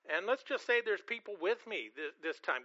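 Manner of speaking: normal talking voice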